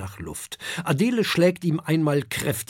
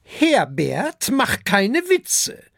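much higher, louder reproach; dragon mother scolding; 9 semitones higher, 4 dB louder, slower than usual. A narrator's reading. Dragon mother tells Herbert off, high and loud. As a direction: rebuke him with a raised voice. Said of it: "sagt die Fressdrachin".

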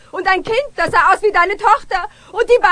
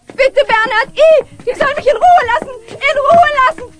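high, louder frantic plea; pleading shout; 6 semitones higher, 4 dB louder than usual. Acted. Woman begging Bernhard to chase the flies away, frantic. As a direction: beg him loudly to shoo them.

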